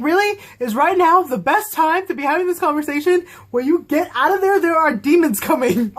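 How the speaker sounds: high-pitched exasperated disbelief; incredulous scolding. Retold or imitated. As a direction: tell off foolish characters like an exasperated fan. Mock scolding of characters mid-escape.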